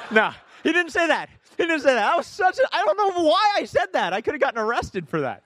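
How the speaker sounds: loud, high-pitched comic backtrack; animated disclaimer. Real, not acted. Preacher admitting he could have been arrested, excitedly. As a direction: burst out loud and high over the joke. Preacher retracts his joke amid laughter.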